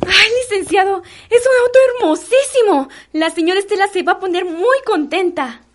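high, loud gushing excitement; very high-pitched, overjoyed exclamation; thrilled delight. Acted. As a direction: squeal it out, delighted at the surprise. Maid thrilled at surprise car.

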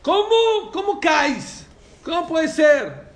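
high, loud scoffing questions; mocking disbelief. Rabbi Meir ridiculing sinners, in a scoffing mock. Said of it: "se reía".

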